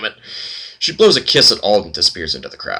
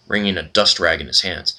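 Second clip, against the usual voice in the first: normal talking voice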